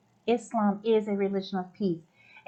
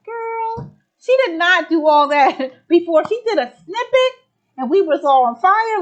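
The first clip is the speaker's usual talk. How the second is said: gushing, raised and loud; excited high praise; 5 semitones higher, 7 dB louder than usual. Real, not acted. Host gushes over the speaker's performance, excited and loud. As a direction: hype up the speaker.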